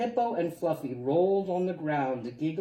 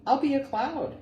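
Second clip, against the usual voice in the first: high, loud burst of a bright idea; excited idea; 11 semitones higher, 5 dB louder than usual. Acted. Little porcupine to himself, thrilled: I'll be a cloud. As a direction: squeal it out, thrilled with the plan.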